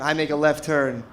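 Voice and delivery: ordinary delivery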